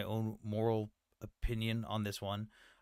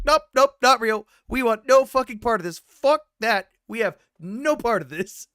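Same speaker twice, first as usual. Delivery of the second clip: loud, much higher panicked denial; mock frantic denial; 10 semitones higher, 11 dB louder than usual. Pakistani defense minister voiced denying involvement, loud high panic. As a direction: yell it like an official backing off fast. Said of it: "Minister of Defense straight up came out and was like".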